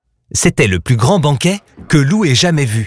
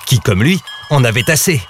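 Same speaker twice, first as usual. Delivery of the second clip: usual speaking voice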